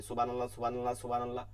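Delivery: quick monotone সুবহানাল্লাহ with clipped vowels; hurried zikr. Retold or imitated. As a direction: rattle off সুবহানাল্লাহ with no stretch. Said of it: "সওয়াব অর্জন করার চেষ্টা করি". (দ্রুত একঘেয়ে জিকির)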